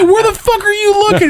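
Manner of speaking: high, loud incredulous shout through laughter; cracking up while demanding; laughing yell. Retold or imitated. Friend retelling the story, shouting through laughter at his cousin.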